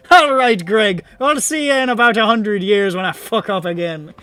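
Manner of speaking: high, loud hearty farewell; breezy trickster. Acted. Skelai bidding the wild huntsman goodbye, loud and breezy. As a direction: call it out like a cheerful rogue.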